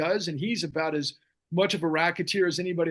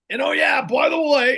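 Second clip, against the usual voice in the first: loud, high, attempted impression; Bernie Sanders; 9 semitones higher, 8 dB louder than usual. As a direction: shout it like a badly faked angry old man. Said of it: "I can't even do a Bernie".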